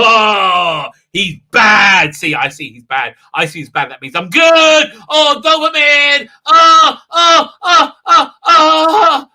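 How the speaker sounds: high, loud, overwrought wailing; mock liberal viewers. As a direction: ham up smug outrage into a wail.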